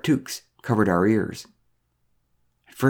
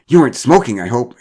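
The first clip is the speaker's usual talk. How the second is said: loud, higher interjection; accusing exclamation; 3 semitones higher, 8 dB louder than usual. A narrator's reading. Mara to Derek, who is quitting, loud and accusing. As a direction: cut in with loud disapproval. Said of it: "exclaimed Mara".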